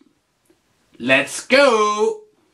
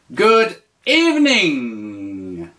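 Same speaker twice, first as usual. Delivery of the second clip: slow, clear teacher model; slow model phrase; 4 semitones lower, much slower than usual. Shown as a demonstration. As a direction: say it slowly for the learner. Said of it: "tu dis".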